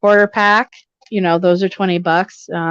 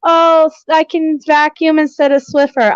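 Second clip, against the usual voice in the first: higher, mock-thrilled self quote; excited about cheap vacuum; 8 semitones higher than usual. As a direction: play up your own silly excitement over a bargain. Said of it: "I literally was like".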